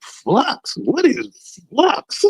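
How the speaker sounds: high, soft, rising question; mock bewildered query; baffled. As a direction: draw out the odd word in puzzlement. Retold bafflement at diagnosis.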